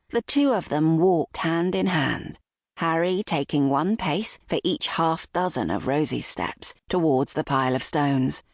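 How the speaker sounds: plain reading voice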